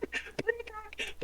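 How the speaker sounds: mock panic. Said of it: "starts fucking screaming".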